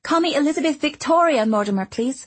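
put-on high, eager chatty girl; bubbly little girl rushing her words; young girl voice. A narrator's reading. Girl insists on name.

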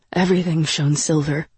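ordinary delivery

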